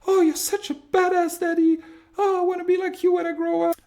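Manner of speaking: put-on very high, loud child voice; excited little kids. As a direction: squeal it like an adoring little kid. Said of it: "your kids look at you and they're like".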